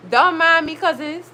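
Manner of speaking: ordinary talk, nothing marked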